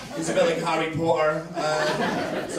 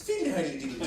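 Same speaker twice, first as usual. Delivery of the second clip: Irish chat-up voice; lightly put-on Irish lilt; Irish accent; 5 semitones lower, 5 dB quieter than usual. Irishman chatting up a girl in a bar, Irish accent. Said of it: "the first one's Irish".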